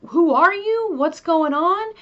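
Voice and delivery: high, loud, panicked questions; a husband suddenly scared of his wife; freaked out husband. Retold or imitated. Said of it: "freaked out a little bit".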